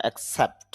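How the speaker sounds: Accept with the stress on the Sept part; stress on second syllable. Shown as a demonstration. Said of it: "সেফের মধ্যে একটু চাপ দিতে হবে". (অ্যাকসেপ্ট-এর Sept অংশে চাপ)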